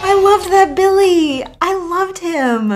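high, laughing shouts of delight; delighted squeal. Real, not acted. Streamer delighted the killer picked up her teammate, high gleeful shout. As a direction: gush with glee.

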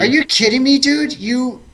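high, loud amazed outburst; excited disbelief. Real, not acted. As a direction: shout it in delighted disbelief. Amazed at big damage roll.

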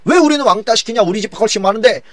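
high-pitched, aggrieved acted complaint; quarrelsome protest. Northern tribes complaining they are left out, quarrelsome high protest. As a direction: protest hotly, voice pitched right up. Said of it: "막 그러면서 거기서 논쟁이 붙은 거예요".

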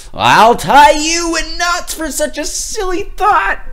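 high, loud furious shout; furious father shouting. Acted. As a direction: roar at the silly thinkers. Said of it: "shouted the girl's father".

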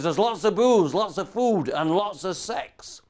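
loud, slow, high Scottish bellow; huge Scotsman inviting him to a ceilidh, Scottish boom; big Scotsman. Retold or imitated. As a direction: boom it out like a giant in a kilt. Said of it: "He says".

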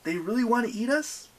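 high, rising disbelief; incredulous question. Acted. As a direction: pitch it up in disbelief. Percy questions the goddess in disbelief.

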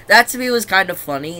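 ordinary talk

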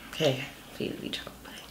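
whispered plea; whispering. Real, not acted. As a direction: pray it under your breath. Hoping before biting gross bean.

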